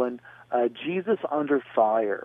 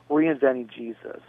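usual speaking voice

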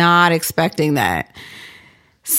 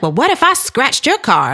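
higher mock threat; retold irate challenge; 7 semitones higher than usual. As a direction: imitate an angry woman daring the other driver. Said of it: "she's just going off".

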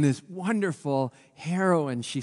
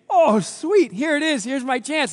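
gleeful; 10 semitones higher, 6 dB louder, slower than usual. Acted. Haman gleeful at his chance.

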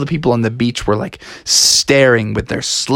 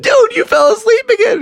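much higher, loud call; 5 dB louder than usual. Pretend. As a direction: shout to wake a sleeper. Waking the sleeping monster.

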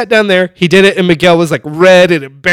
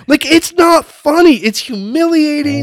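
high, slow, emphatic protest; retold scolding, pitched way up; exasperated complaint; 9 semitones higher, slower than usual. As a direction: stretch it out in mortified indignation. Retold scolding of joking dad.

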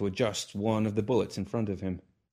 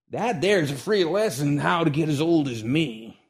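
put-on old man's voice; 6 semitones higher, 5 dB louder than usual. Old gunfighter gives lesson.

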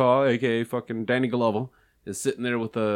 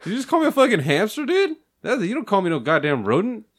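mock offense; 7 semitones higher than usual. Mock offended rant about hamster.

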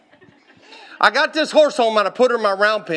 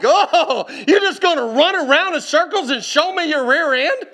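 higher, indignant mock question; acting out a fed-up owner taking offence; 6 semitones higher than usual. Preacher retelling his talk to a stubborn horse, indignant. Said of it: "I started getting hot".

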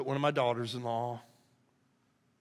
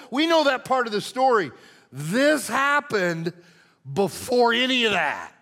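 higher and louder preaching build; full-force preaching; 4 semitones higher, 6 dB louder than usual. Real, not acted. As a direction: preach it with rising passion.

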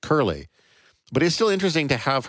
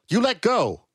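crying out; 11 semitones higher than usual. Acted. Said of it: "she cried".

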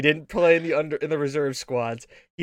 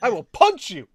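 hammed-up threat, higher and louder; mock threat; 6 semitones higher, 6 dB louder than usual. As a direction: threaten him in jest. Mock threat in retold story.

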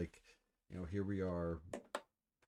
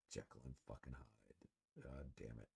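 muttered disgust; 6 semitones lower, 14 dB quieter than usual. Real, not acted. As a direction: mutter it in bitter frustration. Frustrated fan cursing at his team.

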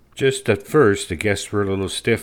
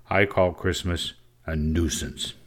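ordinary delivery, nothing marked

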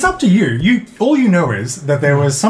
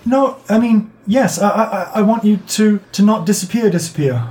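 put-on higher, fumbling child's voice; a scared kid stumbling over his words; nervous little boy; 5 semitones higher than usual. Little boy telling the thief about his parents, nervous and higher. GM holds the boy's voice.

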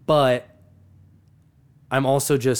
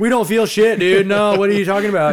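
higher, louder scoffing denial; mocking the friends; 7 semitones higher, 5 dB louder than usual. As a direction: play them off as cocky and clueless. Mocking friends claiming no effect.

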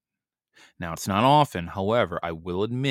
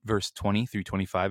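usual speaking voice

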